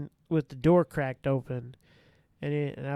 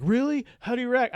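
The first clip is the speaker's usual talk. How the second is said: higher, louder voice of surprise; surprised coworker; 9 semitones higher, 6 dB louder than usual. Retold or imitated. Coworker asks the officer about the run-in, surprised. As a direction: mimic a surprised coworker's question. Coworkers react to his story.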